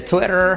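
Twitter, I like E, strong R; Twitter like Tweter. Shown as a demonstration. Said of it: "la I como una E y la R fuerte".

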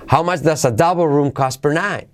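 "double" and "night" accented; question intonation. Shown as a demonstration. Teacher modelling question intonation, "double" and "night" lifted. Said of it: "Acentuaríamos double room cost per night".

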